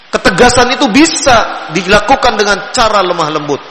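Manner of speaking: ordinary delivery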